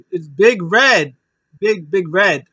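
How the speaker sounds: a hammed-up announcer call; mock commentator hype. Commentator hyping the big man's return, mock excited call. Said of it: "And it was like".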